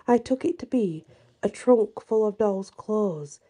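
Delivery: ordinary delivery, nothing marked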